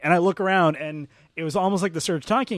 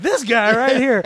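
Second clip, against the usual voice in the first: proud showman; 8 semitones higher, 9 dB louder than usual. Retold or imitated. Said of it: "he's just like".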